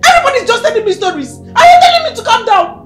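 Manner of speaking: high, loud sobbing outburst; hysterical crying shout. Acted. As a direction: scream through tears at the principal. Hysterical mother refuses to calm.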